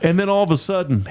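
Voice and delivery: usual speaking voice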